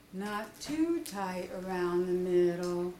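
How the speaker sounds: slow, low, weary donkey; a glum complaint on a sigh; sighing Eeyore. Acted. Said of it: "sighed Eeyore".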